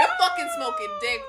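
high laughing yell, half crying with laughter; laughing shout. Retold or imitated. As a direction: shout it while cracking up. Laughing retelling her reply.

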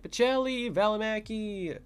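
high, drawn-out cheer of players' names; re-enacted high-pitched fan call. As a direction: holler like a fan at the glass. Fan cheering players at warm-ups.

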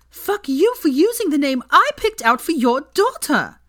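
high, loud furious accusation. A narrator's reading. Hermione raging at her cheating ex in the pub. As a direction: jab each word at him.

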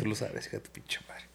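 whispered joking insult. Real, not acted. Amigo le susurra un insulto en broma. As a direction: hiss the playful insult under your breath.

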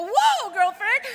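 high, loud, drawn-out whoa; hammed-up shock; astonished outburst. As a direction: blurt it out in loud amazement. Astonished reaction in retold talk.

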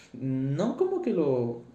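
usual speaking voice

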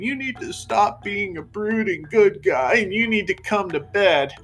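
high, whiny woman voice. Retold or imitated. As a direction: whine it like a needy lover. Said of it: "I can't do that impression".

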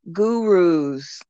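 ordinary talk, nothing marked

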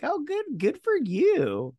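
high, slow, mock-sweet praise; sarcastic praise. Teasing the movie fighters with sarcastic high praise. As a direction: drawl out the fake approval.

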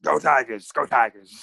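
lightly voiced fired-up coach; rally the team like a coach, lightly; Coach Owen impression. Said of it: "That was good, O".

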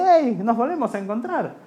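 put-on cheerful kid greeting; inner child. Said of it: "me dice".

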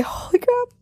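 shocked. Real, not acted. Reacting to own chilling story.

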